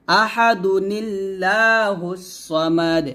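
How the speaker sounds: আহাদু নিল্লাহুস সামাদু chanted slowly, আহাদু joined by noon qutni; slow recitation. Shown as a demonstration. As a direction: recite like tilawat, drawn out. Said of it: "এরকমভাবে আমরা পড়ব". (ধীরে তিলাওয়াতের সুরে)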